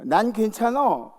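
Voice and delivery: smug self. Retold or imitated. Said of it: "그게 제일 문제라고".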